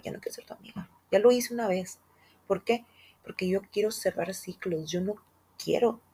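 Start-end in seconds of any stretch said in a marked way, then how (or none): 0.0-0.9 quick retold line in a whisper; whispered quote. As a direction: breathe it out fast. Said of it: "les digo de frente". (susurro rápido de una cita)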